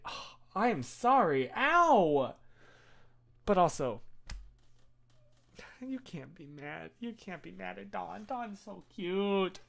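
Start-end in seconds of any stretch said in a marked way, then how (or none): 1.5-2.4 mock yelp of pain. Retelling the dog's bite. 5.9-9.6 tender softness. Real, not acted. Doting on the dog.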